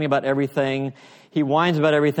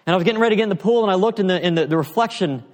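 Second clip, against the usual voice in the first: eager retelling; 6 semitones higher, 4 dB louder, much faster than usual. Retold or imitated. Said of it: "He said".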